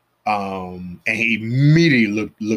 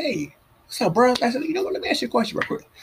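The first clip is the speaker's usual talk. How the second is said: playful question voice; faster than usual. Retold or imitated.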